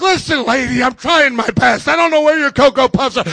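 hammed-up frustrated retort; exasperated pleading. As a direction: protest back at an angry complainer. Acted argument with angry mother.

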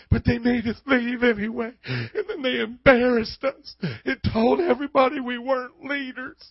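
a touch of put-on Southern whine; Southern sob story. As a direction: finish the overdone Southern complaint. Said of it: "They became Southern".

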